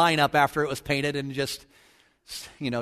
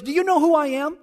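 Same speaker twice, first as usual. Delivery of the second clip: high, self-important mock outrage; indignant; 9 semitones higher than usual. President reminds his wife who he is, mock indignation. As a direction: imitate an affronted bigwig. Husband pulls rank, acted.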